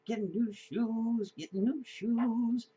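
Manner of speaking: put-on high, slow sing-song kid voice; excited child. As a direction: echo a happy little kid.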